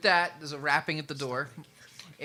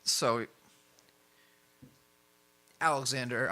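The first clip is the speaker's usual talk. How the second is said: ordinary talk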